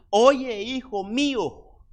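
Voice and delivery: madre que llama a su hijo, aguda y fuerte; llama al hijo como una madre, alzando la voz. Acted. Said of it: "Una madre". (una madre llamando a su hijo con voz alta y fuerte)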